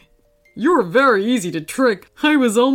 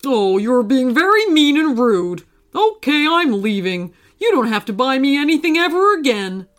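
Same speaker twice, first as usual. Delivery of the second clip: put-on character voice, indignant; offended huff; 3 semitones higher, 3 dB louder than usual. Pizza quarrels before storming off, offended and huffy. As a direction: flounce off in a huff.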